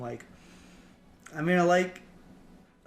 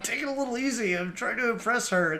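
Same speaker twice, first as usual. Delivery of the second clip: raised-pitch, stammering plea to go easy; pleading older brother; 8 semitones higher, 4 dB louder than usual. Retold or imitated. Gohan trying to impress a girl, mock pleading. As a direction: imitate an embarrassed brother asking a favour. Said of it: "and he's like".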